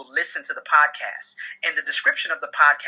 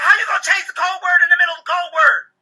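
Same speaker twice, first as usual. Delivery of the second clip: high-pitched screamed protest; loud, exasperated acted husband; yelling; 12 semitones higher, 6 dB louder than usual. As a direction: yell in outraged disbelief. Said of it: "You yelling at me? You screaming at me?".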